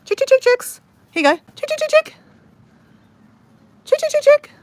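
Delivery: high, loud sing-song chicken call. Real, not acted. Calling chickens to eat.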